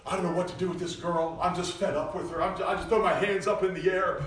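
a fed-up father throwing up his hands; whiny complaint. Retold or imitated. Preacher mimics a fed-up father's whine. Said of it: "Brother Zach blaming his".

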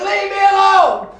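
loud, high shout of leave me alone; angry shout. Retold or imitated. Niece shouting at the preacher to leave her be, loud angry yell. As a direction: yell it at him to back off.

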